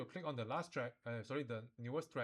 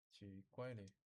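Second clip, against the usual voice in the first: puzzled gasp; 8 dB quieter, slower than usual. Real, not acted. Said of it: "gasps at odd result".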